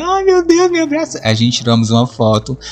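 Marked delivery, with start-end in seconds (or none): 0.0-1.2 very high squealing falsetto; shrieking high fan voice; hysterical fan squeal. Retold or imitated. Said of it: "Essa coisa bem fã histérico".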